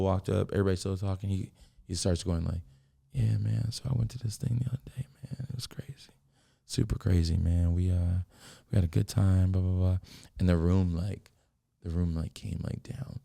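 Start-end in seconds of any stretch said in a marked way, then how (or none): 3.1-10.0 quiet mock-casual story voice; quiet chill guy. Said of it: "his voice went up".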